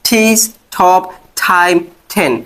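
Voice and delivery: ঠিস, ঠপ, টাইম, টেন with a breathy aspirated t; aspirated t demo. Shown as a demonstration. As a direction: push a puff of air on each t. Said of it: "ঠ দিয়ে উচ্চারণ করব।".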